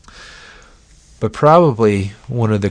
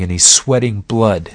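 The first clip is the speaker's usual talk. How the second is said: usual speaking voice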